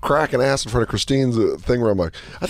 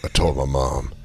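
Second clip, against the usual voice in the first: grim war vet. Pretend. Parodying the girl's story as a grim war veteran. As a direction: tell it like a weary soldier recalling battle.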